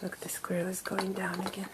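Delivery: quick, hushed, no voice; fast whisper. Acted. Teacher hushing kids to watch the squirrel.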